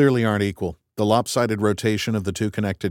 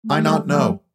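an alien friend scolding, word by word; Rocky alien voice; 5 semitones higher, 3 dB louder than usual. Acted. Rocky speaks.

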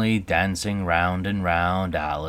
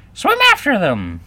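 high, loud Griffin scream; 10 dB louder than usual. A narrator's reading. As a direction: scream it out as an excited creature. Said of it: "screamed the Griffin".

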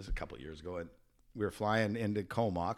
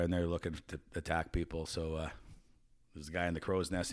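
normal talking voice

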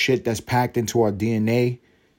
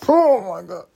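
exasperated cry; 10 semitones higher, 6 dB louder than usual. Real, not acted. Exasperated about the child's mom.

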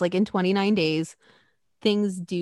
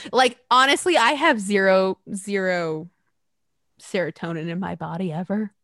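ordinary talk

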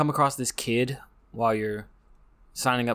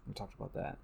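whispered to himself; 17 dB quieter, faster than usual. Real, not acted. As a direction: let it slip out as a voiceless aside. Whispered repeat.

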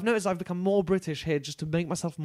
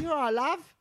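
much higher mock British voice; Eleanor Conway accent; 12 semitones higher, slower than usual. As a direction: nail the friend's British accent. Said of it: "that's so her accent".

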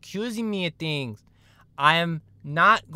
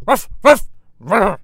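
dog barks; 3 semitones higher, 6 dB louder, slower than usual. Acted. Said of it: "Dog the Bounty Hunter".

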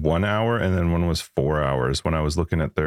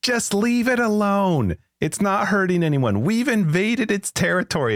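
preachy do-gooder; 13 semitones higher, slower than usual. Retold or imitated. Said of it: "those people that are like".

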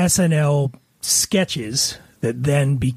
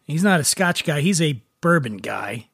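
usual speaking voice

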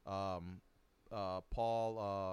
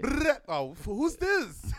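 playful put-on voice; 13 semitones higher, 7 dB louder, much slower than usual. Mock phone call voice.

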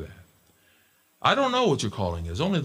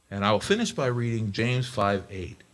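ordinary delivery

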